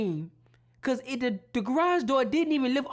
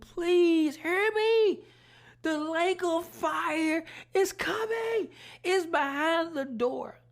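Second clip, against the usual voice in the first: slow, higher pleading; pleading cry; 6 semitones higher, slower than usual. Retold or imitated. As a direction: beg them to listen. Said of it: "And I screamed".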